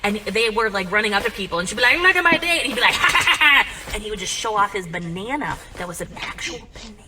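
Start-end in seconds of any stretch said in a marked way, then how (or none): 2.0-2.6 loud, high excited announcement; gleeful showing off. Retold or imitated. Mom showing off to people. 2.9-3.9 loud, high staccato cackle; imitated ha-ha laugh, pushed out hard; mock flasher laugh.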